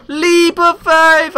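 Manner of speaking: high, slow heroic cry; dramatic battle cry. Pretend. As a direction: announce the leap like an epic hero. Dramatic leap announcement.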